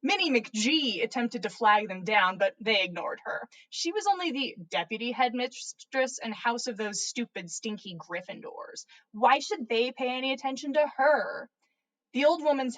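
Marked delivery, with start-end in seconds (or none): none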